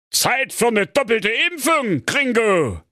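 gunslinger king. Acted. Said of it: "sagte König Pedro".

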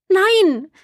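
hoher, lauter Aufschrei des Entsetzens; entsetzter Ruf. A narrator's reading. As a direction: schrei erschrocken auf, dass Papa das ernst meint. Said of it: "rief Maya entsetzt". (Maya ruft entsetzt zu Papa: Nein)

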